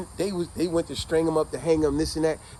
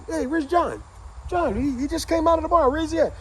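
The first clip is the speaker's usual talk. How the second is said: puzzled friends asking; 11 semitones higher than usual. Retold or imitated. Friends search for John.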